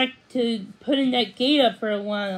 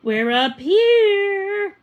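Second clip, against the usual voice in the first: higher, louder, slow call from upstairs; 9 semitones higher, 6 dB louder, much slower than usual. A narrator's reading. Mom calls down from upstairs, slow and loud. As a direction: stretch it out to carry down the stairs. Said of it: "Mom called".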